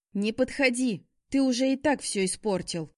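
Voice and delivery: high, loud angry warning. A narrator's reading. She stops Shaun approaching her, warning sharply. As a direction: warn him to keep away. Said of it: "предупреждающе проговорила я".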